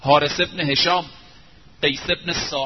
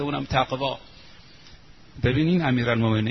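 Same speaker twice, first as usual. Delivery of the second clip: ordinary delivery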